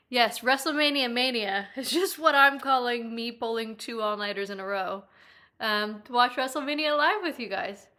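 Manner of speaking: ordinary talk, nothing marked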